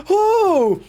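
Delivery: high teasing ooh; mock scandalized ooh. Reacting to the drama, high teasing ooh. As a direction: coo like a gossip.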